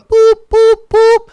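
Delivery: high loud beeping tones; phone beeps. Retold or imitated. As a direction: beep like a phone hanging up. Imitating phone hang-up tones.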